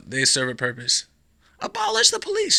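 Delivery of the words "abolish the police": much higher squeaky protester voice; mock protester. Mimicking a protester shouting a slogan, much higher. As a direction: chant it like a shrill activist.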